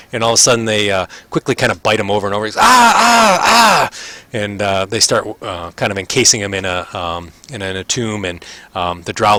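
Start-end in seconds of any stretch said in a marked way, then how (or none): 2.6-3.9 loud, high repeated screams; acted high-pitched agony cries; screams of pain. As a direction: scream as if being bitten over and over. Ront bitten by spiders.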